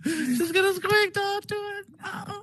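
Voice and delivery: very high, thin squeak of a voice; squeaky high voice. Shown as a demonstration. Host squeaking high to make the device squeak again. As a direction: pitch way up to coax the squeak. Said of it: "If I keep talking like this".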